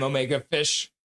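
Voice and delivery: usual speaking voice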